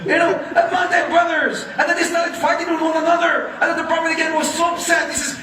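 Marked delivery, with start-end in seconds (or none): none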